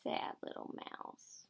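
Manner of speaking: very soft whisper; whispered aside, barely voiced; hushed sympathy. A narrator's reading. Reader pitying sad little Mouse, in a hushed whisper.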